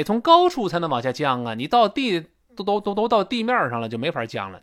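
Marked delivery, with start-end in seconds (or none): none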